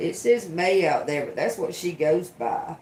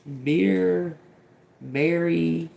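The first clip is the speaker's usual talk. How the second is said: ordinary talk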